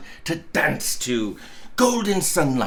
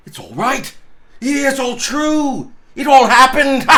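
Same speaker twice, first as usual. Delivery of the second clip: Scrooge, higher and louder, breathless with joy; Scrooge exulting; 5 semitones higher, 4 dB louder than usual. Acted. Scrooge frisking round the fireplace, exulting. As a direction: point round the room, giddy and raised.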